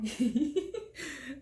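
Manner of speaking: high, loud real laugh at her own joke; burst of laughter. Real, not acted. Laughs after teasing the ending.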